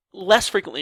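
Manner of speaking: ordinary delivery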